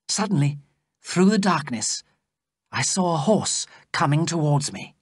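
ordinary delivery, nothing marked

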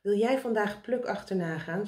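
plain reading voice

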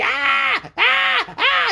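high, loud screeches like a trapped ferret; mimicked wailing infant scream; imitated baby screaming. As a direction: shriek in short repeated bursts. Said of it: "I'm woken up to screaming and crying".

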